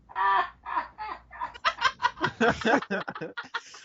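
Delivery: long, high-pitched put-on cackle; drawn-out wheezy snicker; mischievous cackle. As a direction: cackle like an old prankster at the back. Old man cackling at back.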